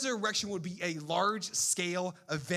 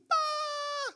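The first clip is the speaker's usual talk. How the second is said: mock scream. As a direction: hold a terrified mock shriek. Women screaming in the tomb.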